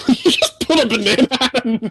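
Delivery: high, fast, laughing through the words; cracking up. Real, not acted. Host to friend with a sudden banana, cracking up. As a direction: squeak it out while laughing hard.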